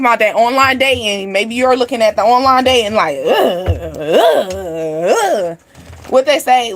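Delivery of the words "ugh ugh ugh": low acted "ugh" groans; drawn-out, low-pitched groan of distaste; disgusted groans. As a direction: groan three times in mock revulsion. Acting disgust at online dating.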